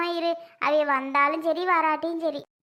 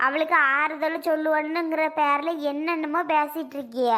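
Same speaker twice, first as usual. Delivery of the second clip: ordinary talk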